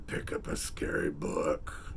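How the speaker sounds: low spooky whisper. Acted. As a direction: whisper it like a ghost story. Spooky sign-off.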